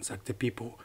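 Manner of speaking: plain reading voice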